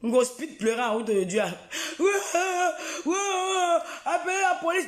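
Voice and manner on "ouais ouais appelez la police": mock cry for police. Imitating her panic.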